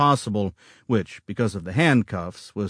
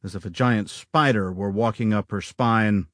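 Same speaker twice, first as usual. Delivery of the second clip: ordinary delivery, nothing marked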